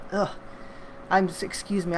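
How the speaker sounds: pained groan from heartburn. Real, not acted. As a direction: uncomfortable sour-stomach ugh. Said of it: "I have such a bad, um, heartburn today".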